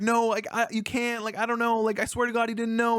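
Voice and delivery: high, desperate pleading. Retold or imitated. Amir pleading with Robin that he didn't know, high and desperate. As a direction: beg to be believed. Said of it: "he's just pleading with him".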